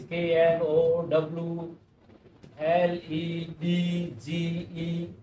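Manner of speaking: K N O W L E D G E named slowly one letter at a time, stretching the last ones; spelled letter by letter. Shown as a demonstration. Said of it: "English में spelling होती है नॉलेज". (एक एक अक्षर धीरे धीरे बोलकर)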